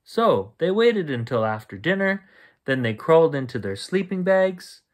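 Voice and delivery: ordinary delivery, nothing marked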